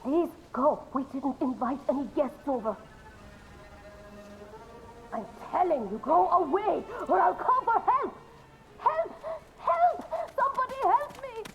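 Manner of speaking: high, panicked cries for help; frightened woman. Acted. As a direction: scream for help through the door. Frightened woman behind door.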